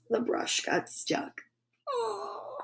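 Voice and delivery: hushed reveal. A narrator's reading. Narrator whispering that the brush is stuck. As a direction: breathe the bad news.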